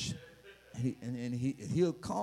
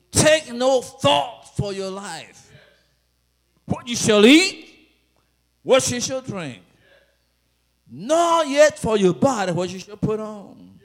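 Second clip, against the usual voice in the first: full-force preaching; 4 semitones higher, 10 dB louder than usual. Acted. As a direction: proclaim the verse to the whole church.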